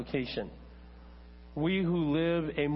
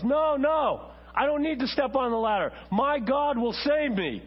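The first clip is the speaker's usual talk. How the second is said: high, loud refusal; defiant shout; 9 semitones higher, 4 dB louder than usual. Acted. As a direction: call up to the rescuer, high and insistent. Acted stranded believer refusing rescue aloud.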